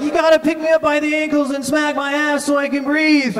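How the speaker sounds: high-pitched, tearful pleading; mock crying. Friend in the wrecked car begging for help, mock crying. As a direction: play a hammed-up crying plea, voice pushed high. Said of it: "Rich, he starts crying".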